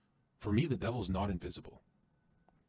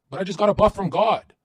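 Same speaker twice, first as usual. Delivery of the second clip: loud, higher, pumped up; excited; 9 semitones higher, 11 dB louder than usual. Real, not acted. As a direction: burst out with triumph. Excited boast.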